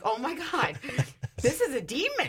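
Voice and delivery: the penny drops; sudden realization. Retold or imitated.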